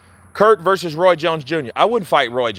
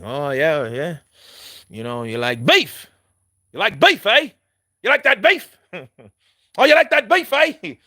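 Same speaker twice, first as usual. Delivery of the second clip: taunting Billy Joe; 2 semitones higher than usual. Retold or imitated. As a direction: goad him, climbing up in pitch. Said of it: "He was like".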